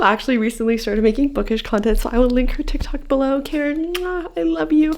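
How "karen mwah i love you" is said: high, slow, fond mwah; calling out love to best friend Karen; kiss to Karen. Real, not acted. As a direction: blow a kiss down the lens.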